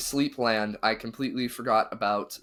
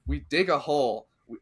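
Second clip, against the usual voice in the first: normal talking voice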